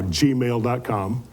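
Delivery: ordinary talk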